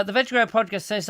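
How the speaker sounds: ordinary talk, nothing marked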